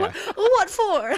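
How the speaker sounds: put-on over-the-top Southern accent. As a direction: stretch it out in a hammed Southern twang. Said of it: "that was my Southern accent".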